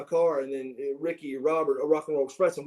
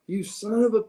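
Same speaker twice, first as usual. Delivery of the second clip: high, mock-angry cursing; acted outburst, pitched up; exasperated dad; 7 semitones higher than usual. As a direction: imitate a dad blowing up, cut short. Dad acted exploding at son.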